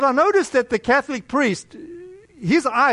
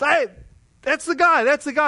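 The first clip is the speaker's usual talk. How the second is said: excited calling out; 6 semitones higher than usual. Retold or imitated. Kids calling out from car windows.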